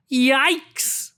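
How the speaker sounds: a playful jump; mock fright. A narrator's reading. Narrator startled by the whale, mock fright.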